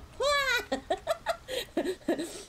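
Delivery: squealing high laugh. Real, not acted. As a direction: burst out laughing. Laugh after dying.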